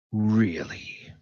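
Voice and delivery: a flat, sceptical Really; scoffing disbelief. Acted. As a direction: scoff at the excuse, unimpressed. Bazranik scoffs at Taskmaster.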